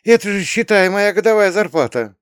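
high, loud astonishment; amazed mother. A narrator's reading. Mother marvelling at a fee on the way to the metro, astonished. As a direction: exclaim in high-pitched disbelief. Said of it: "не переставала изумляться мама".

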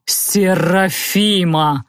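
languishing prince calling his beloved; ardent suitor. A narrator's reading. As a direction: draw the name out with pompous longing.

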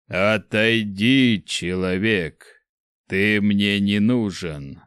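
slow, gurgling monster voice; deep bubbling voice. A narrator's reading. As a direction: growl it out slowly, bubbling. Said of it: "раздался глубокий, клокочущий голос". (глубокий клокочущий голос)

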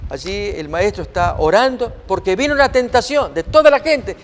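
ordinary talk

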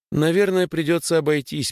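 put-on high-pitched voice of a girl agreeing; supportive girl character. A narrator's reading. (голос девочки, повыше, с поддержкой)

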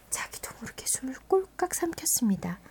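hushed suspense narration; whispering. A narrator's reading. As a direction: whisper it, holding breath. Tense moment in the story. (숨죽인 속삭임)

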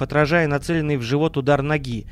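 ordinary delivery

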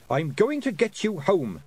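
high, firm retort; snapped. A narrator's reading. Girl promising to get them home, snapping. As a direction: snap it with determination. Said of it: "snapped Tiffany".

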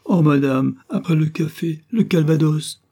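the maid moaning over a second spill; rising exclamations of dismay; despairing cry. A narrator's reading. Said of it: "La bonne s'écria avec désespoir".

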